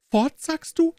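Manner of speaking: put-on high-pitched child's startled question; very high little-kid voice, taken aback; little girl exclaiming. A narrator's reading. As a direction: cry it out as a small girl. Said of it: "rief die Kleine".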